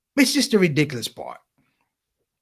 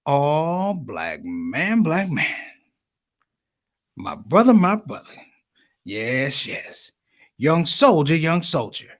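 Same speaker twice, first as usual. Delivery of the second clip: Nation of Islam brother; much slower than usual. Retold or imitated. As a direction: imitate a solemn brother saying everything twice. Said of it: "they say it twice to let you know they mean it".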